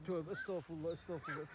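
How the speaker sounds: almost silent, under the breath; muttered prayer. Real, not acted. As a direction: mumble a pious exclamation to yourself. Muttered pious exclamation. (fısıltıyla tövbe etme)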